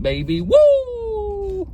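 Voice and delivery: drawn out then a high whoop; celebrating. Real, not acted. Marking day ninety-eight, a real celebratory whoop. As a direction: cheer the milestone.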